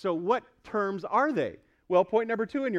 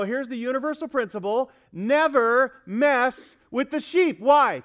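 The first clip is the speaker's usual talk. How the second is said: raised voice, slowing to hammer out each word; emphatic principle; 6 semitones higher, 5 dB louder than usual. Real, not acted. Pastor warning fellow pastors about God's sheep, emphatic principle. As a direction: lay down the rule, loud and deliberate.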